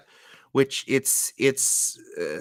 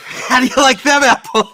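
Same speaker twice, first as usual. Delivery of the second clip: shouted movie quote; 11 semitones higher, 12 dB louder than usual. Retold or imitated. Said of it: "quoting Good Will Hunting".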